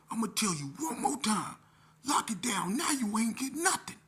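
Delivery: loud, high final warning; angry ultimatum. Retold or imitated. Cantu telling youth to lock down, angry ultimatum. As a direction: shout the order as a last warning. Staffer's last warning in argument.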